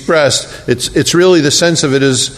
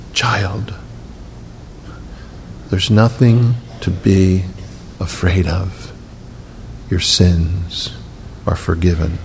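low, soft and slow, simply stating it; calm, informative; 7 semitones lower, 6 dB quieter, slower than usual. Shown as a demonstration. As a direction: tell a frightened man gently that there is nothing to fear. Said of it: "an informative way to say it that's not exhortive".

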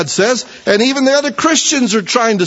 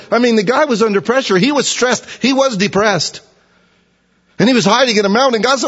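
ordinary talk

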